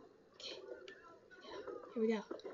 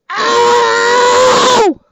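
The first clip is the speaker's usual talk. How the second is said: long, very loud high scream; 7 semitones higher, 18 dB louder than usual. Real, not acted. Gamer reacting to his game, long screamed ow. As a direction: scream it out and hold it.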